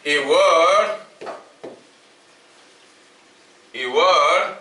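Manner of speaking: slow, word-by-word dictation with long pauses; dictating notes. Acted. Teacher dictating a definition to the class, slow dictation. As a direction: dictate each phrase slowly so students can write it down.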